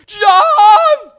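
high, full-force scream of a name. Retold or imitated. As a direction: scream to a friend over the spinning ride. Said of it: "just scream".

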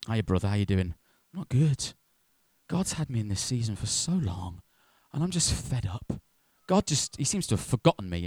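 low, subdued grumbling; acted downcast churchgoer. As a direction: confide it quietly, mildly fed up. Acted gloomy chat between church members.

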